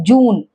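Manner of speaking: जून with a pure long 'oo', no y-glide; oo sound. Shown as a demonstration. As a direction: say जून as 'joon'. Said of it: "ऊ sound आती है इसमें". (जून, लंबे ऊ के साथ)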